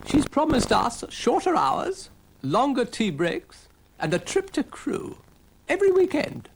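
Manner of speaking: high, slow, puffed-up train voice. Acted. Train boasting to Dougal, high slow smug voice. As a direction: list the promises smugly.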